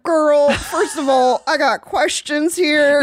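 slow, drawn-out sassy comeback; slow, sassy girlfriend retort; sassy reply. Retold or imitated. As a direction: stretch it out, unimpressed. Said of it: "And I was like".

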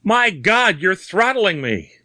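very high, desperate cry; panicked, high-pitched protest; panted terror. A narrator's reading. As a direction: cry out as if being strangled. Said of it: "he panted".

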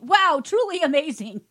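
loud, high, over-the-top gushing; big fake-impressed exclamation; mock amazement. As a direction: rave like a hammed-up reviewer. Reading gushing mock-review comment aloud.

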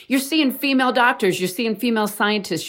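ordinary talk

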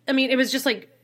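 ordinary delivery

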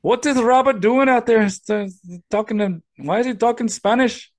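high-pitched fretting parent voice; bewildered parent. Acted. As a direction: question it in a high, put-on voice. Second speaker plays the other parent.

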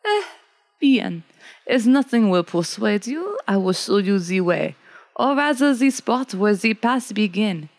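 put-on resigned French accent; French woodsman. Coureur de bois agrees to show the way, resigned French accent. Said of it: "The coureur de Bois sighs and says".

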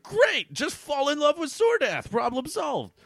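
very high, gleeful character voice; hammed-up high-pitched enthusiasm; mock excitement. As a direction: squeal it as if the problem's solved. Acting out Conrax's delighted reply.

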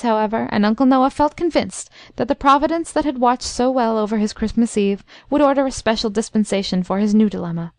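usual speaking voice

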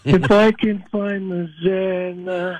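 very slow calm delivery; slowed-down zen. Shown as a demonstration. A caller showing the hosts he can slow down, very slowly. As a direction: stretch every word as if finding the zen. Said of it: "I'm doing that right now".

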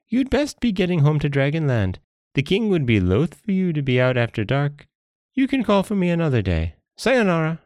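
high-pitched monkey sending the jellyfish off; breezily see him off in a high monkey voice; dismissive wily monkey. Acted.

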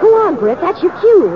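urgent prompting. Real, not acted.

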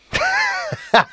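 high shrieking burst of laughter. Real, not acted. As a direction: howl with laughter. Crude joke, bursts out laughing.

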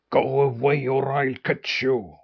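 watchdog growl, lightly voiced; growled warning. A narrator's reading. Watchdog pokes his head out at the fox, growled warning. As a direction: growl it at an intruder. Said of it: "growled at him".

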